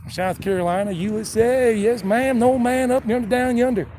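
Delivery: Southern yes-ma'am country accent; South Carolinian accent. Shown as a demonstration. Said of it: "sometimes it sounds like a South Carolinian".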